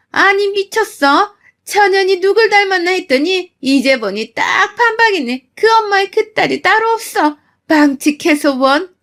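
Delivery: mother-in-law shrieking back at the mother; shrill mother-in-law. Acted. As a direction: screech back in outrage.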